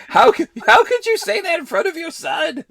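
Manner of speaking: high-pitched, laughing mock outrage; incredulous squeal, cracking up; laughing disbelief. Real, not acted. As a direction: scold him loudly through real laughter. Laughing mock outrage at co-host's joke.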